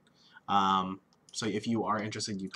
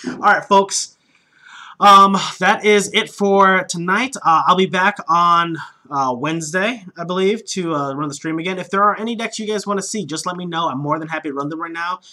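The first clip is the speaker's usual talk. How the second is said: louder, higher announcer-style outro; streamer sign-off; 8 semitones higher, 14 dB louder than usual. Acted. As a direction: address the viewers like a host.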